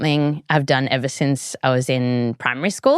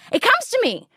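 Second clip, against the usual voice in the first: ordinary talk, nothing marked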